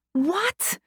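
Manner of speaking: high disbelieving scream. A narrator's reading. Screaming after the medic in disbelief. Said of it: "I scream".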